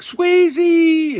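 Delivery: high, loud drawn-out call of a pet's name; imitated excited cry. Neighbors spotting their long-lost cat, imitated excited cry.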